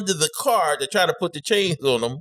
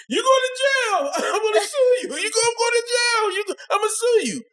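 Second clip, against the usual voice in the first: imitating screaming victim. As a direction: scream it from the ground like you're hurt and furious. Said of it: "He was on the ground screaming".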